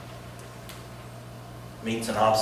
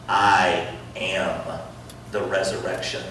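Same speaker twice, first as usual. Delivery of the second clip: solemn Jesus; 7 semitones lower, 3 dB quieter than usual. Acted. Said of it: "Jesus said".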